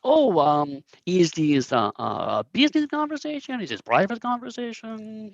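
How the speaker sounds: hesitant fake Korat accent, climbing high; high, halting hammy Korat accent; Mister Meow. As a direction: ask delicately in a bad Korat accent. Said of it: "very thick, um, Korat accent".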